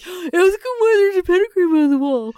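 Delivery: high, put-on voice mimicking the guys; mock high whiny imitation. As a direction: squeak it out like a mocking friend. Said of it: "they would be like".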